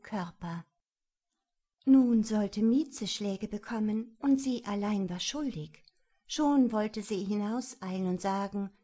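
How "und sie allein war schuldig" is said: plain reading voice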